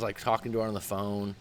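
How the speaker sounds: usual speaking voice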